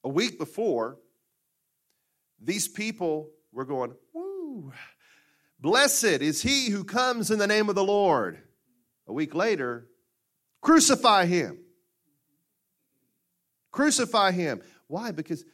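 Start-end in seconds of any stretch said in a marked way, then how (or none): 4.1-8.4 high whoop then a loud, slow proclamation; cheering crowd. Acted. Preacher voicing the crowd welcoming Jesus, loud slow cheer. 10.6-14.6 loud, high, slow chant of crucify; shouting mob. Acted. Preacher voicing the crowd turning on Jesus, loud mob shout.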